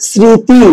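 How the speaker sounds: স্মৃতি said as smriti; pronunciation demo. Shown as a demonstration. Teacher modelling the word for students, clear and deliberate. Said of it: "তাই এটার উচ্চারণ হবে".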